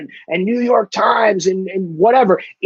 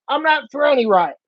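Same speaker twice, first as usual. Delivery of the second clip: ordinary delivery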